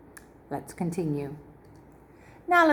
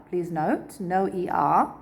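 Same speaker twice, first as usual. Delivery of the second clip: normal talking voice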